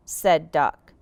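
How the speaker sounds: ordinary delivery, nothing marked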